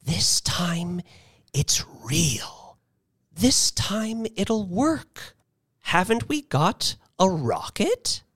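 high, urgent stage whisper; hopeful whisper, pitched high. A narrator's reading. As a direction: whisper it, willing it to be true. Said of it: "she bit at her suspicion and whispered".

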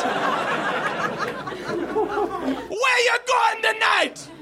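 high slow shouted Jamaican voice; Jamaican accent. Acted. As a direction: stretch it out in a Jamaican yell. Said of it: "admiring my Jamaican accent".

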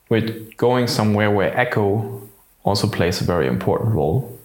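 usual speaking voice

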